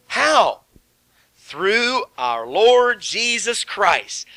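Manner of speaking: very slow, drawn-out verse reading; scripture reading. Acted. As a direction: stretch each word for the congregation. Reads verse, prompts congregation.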